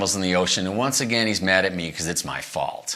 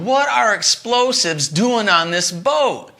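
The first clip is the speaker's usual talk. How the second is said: alarmed question; 12 semitones higher, 5 dB louder, slower than usual. Retold or imitated. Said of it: "and I said".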